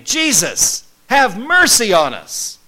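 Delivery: high, loud plea, slow on mercy; desperate shouting. Retold or imitated. Lepers calling out to Jesus, loud slow plea. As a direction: beg loudly across the distance. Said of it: "They were shouting".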